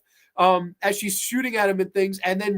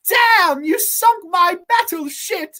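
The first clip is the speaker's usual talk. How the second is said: high, loud, drawn-out exclamation; hammed-up high-pitched game outburst; mock outraged yell; 12 semitones higher, 7 dB louder, slower than usual. Sisters playing battle shit.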